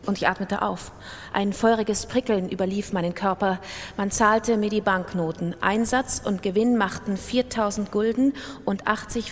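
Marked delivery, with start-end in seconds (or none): none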